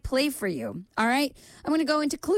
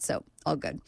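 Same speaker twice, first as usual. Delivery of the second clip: ordinary talk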